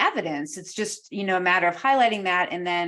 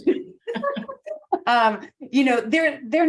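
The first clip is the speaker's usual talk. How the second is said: laughing; 7 semitones higher, 4 dB louder, much slower than usual. Real, not acted. Laughs at getting title wrong.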